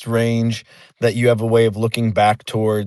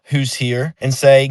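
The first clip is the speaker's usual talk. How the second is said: ordinary talk, nothing marked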